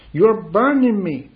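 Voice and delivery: mock demoniac cry. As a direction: cry out like a tormented demoniac, in a preacher's retelling. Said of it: "freak and go on crying out".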